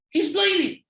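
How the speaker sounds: high, loud mock panic; imitated freak-out. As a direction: act out a partner freaking out. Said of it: "His partner freaks out".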